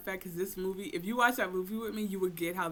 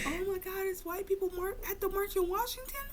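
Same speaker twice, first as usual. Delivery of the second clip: much higher, mock-horrified gasp; pitched-up mock outrage at the March; mock shocked voice; 12 semitones higher than usual. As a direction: play a clutching-pearls onlooker.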